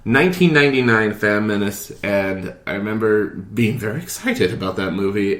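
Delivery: ordinary talk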